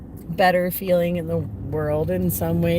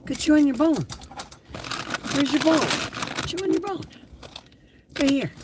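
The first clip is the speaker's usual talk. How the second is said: high singsong talk to the dog; high puppy talk; 7 semitones higher than usual. Real, not acted. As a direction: coax the puppy to his bone.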